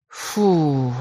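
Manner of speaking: plain reading voice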